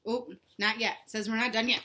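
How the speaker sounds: usual speaking voice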